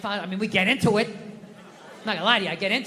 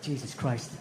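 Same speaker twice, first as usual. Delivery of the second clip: low muttered fury; 6 semitones lower than usual. Retold or imitated. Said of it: "I just absolutely lost it".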